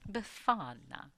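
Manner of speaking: slow Befana with a light Italian lilt; drawn-out Befana, lightly Italian; mock Italian Befana; slight Italian accent. Shown as a demonstration. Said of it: "Say it like you have a slight accent".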